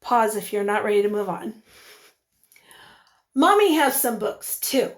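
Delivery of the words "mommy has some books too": ordinary talk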